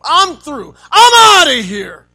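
loud, high exasperated yelling; fed up shout. Pretend. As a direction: shout like a spouse at the end of their rope. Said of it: "It means just be fed up".

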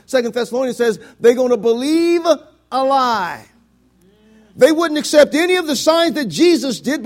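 loud, insistent preacher; preaching at full force. Real, not acted. Preacher warns church about the Antichrist, preaching at full force.

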